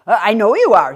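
a hint of a flustered old gentleman; polite old man.

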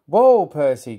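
storyteller's urgent whoa; calling out. A narrator's reading. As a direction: shout to halt a runaway. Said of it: "called his driver".

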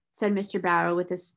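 usual speaking voice

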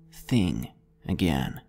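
usual speaking voice

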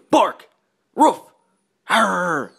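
playful barking command; loud dog barks. Pretend. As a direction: bark it out on three. Commanding her to bark.

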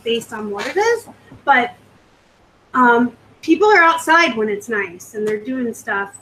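ordinary talk

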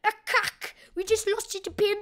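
high-pitched South African impersonation; hammed-up South African exclamation; South African accent. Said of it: "give you a South African impersonation".

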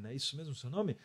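ordinary talk

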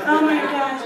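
high, loud startled oh my gosh; surprised gasp. Retold or imitated. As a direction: blurt it out in shock. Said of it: "and I was like".

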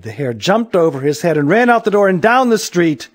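usual speaking voice

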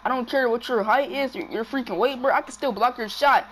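loud, high taunting at the screen; trash talk. Real, not acted. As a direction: yell it at the player, mocking. Trash talking the game player.

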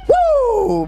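very high wrestling-style woo yell; wrestler woo. Acted. Pastor teasing the blond usher with a high wrestler woo. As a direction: let out a ringing Ric Flair woo. Hyping the nature boy.

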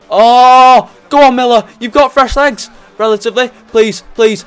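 loud, high cheering; excited shout. Real, not acted. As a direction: roar him on towards goal. Late winner chance, shouting.

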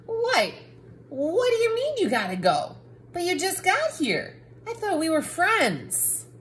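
high, whiny dismay; hurt surprise. Acted. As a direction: plead with a friend who is leaving. Book hurt the reader leaves.

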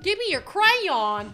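slow, loud, deliberate crayon; slow stressed crayon. Shown as a demonstration. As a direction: Give me your crayon, said slowly and loud. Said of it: "I mean, not crayon".

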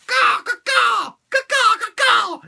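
high, drawn-out cawing; mock bird caws. Imitating the toy bird, high mock squawks. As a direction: screech like a cartoon bird.

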